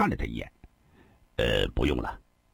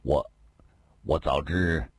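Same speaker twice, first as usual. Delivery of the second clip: weary sigh; 6 semitones lower than usual. A narrator's reading. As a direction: sigh out the guilt to the elder brother. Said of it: "长叹一声".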